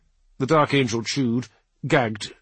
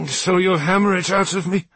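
bitter taunt; 7 semitones higher, 6 dB louder than usual. Acted. Wounded prisoner taunting captor.

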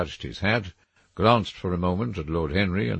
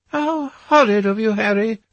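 much higher, louder mock-shocked lady; Duchess cries out; 7 dB louder than usual. A narrator's reading. The Duchess rebuking Harry, much higher and louder. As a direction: tease him with a shocked cry. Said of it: "cried the Duchess".

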